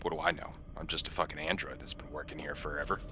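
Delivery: ordinary delivery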